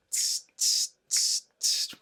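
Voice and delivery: four unvoiced, evenly spaced "tss"; hissed count-in. Shown as a demonstration. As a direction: tick off the beat softly with "tss". Counts in the beat before playing.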